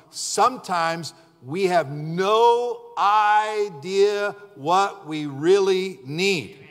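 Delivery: no drawn out long and slow; preached full out. Shown as a demonstration. The preacher declares it from the top of his lungs, slow and emphatic. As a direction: stretch every word, very slow. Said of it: "from the top of my lungs".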